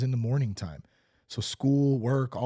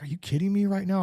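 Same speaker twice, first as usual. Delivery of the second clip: high, incredulous exclamation; can't believe what he's seeing; disbelief; 9 semitones higher, faster than usual. Retold or imitated. Finding a Bible in the road, high incredulous disbelief. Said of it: "I'm like".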